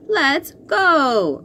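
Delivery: bright excited shout. A narrator's reading. Robot setting off on skateboard, shouting. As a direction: call it out eagerly. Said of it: "Robo Pete shouts".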